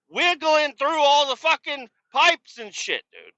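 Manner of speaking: high-pitched ranting scolding, acted out; exasperated yelling. Yelling down at the guy tangled in his harness, exasperated.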